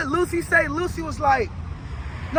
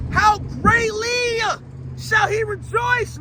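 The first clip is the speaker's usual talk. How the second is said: raised, drawn-out proclamation; forceful psalm preaching; 6 semitones higher, slower than usual. Acted. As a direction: build to a stretched final shout. Preacher declaims and repeats psalm.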